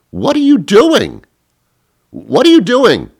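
squealing incredulous rant; exasperated yell. Retold or imitated. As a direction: shout it at the screen in disbelief. Exasperated rant at Facebook poster.

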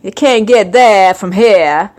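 put-on slow New England Maine accent. Host giving examples of regional speech, Maine accent. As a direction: drop the R's like a slow Mainer. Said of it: "New England, Maine".

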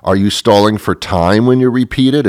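ordinary delivery

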